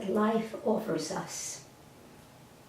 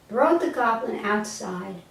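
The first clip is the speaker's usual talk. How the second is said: ordinary delivery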